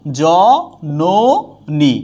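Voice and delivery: জননী drawn out very slowly; slow demo pronunciation. Shown as a demonstration. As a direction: elongate জননী as a model. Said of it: "teacher demonstrates pronunciation".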